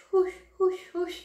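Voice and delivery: high, loud whoosh noises like wind; whooshing camel sound. Acted. Telling a camel joke to viewers, high loud whooshing.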